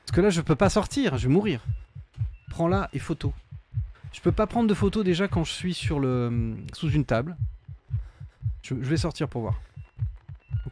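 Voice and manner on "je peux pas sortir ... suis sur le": ordinary talk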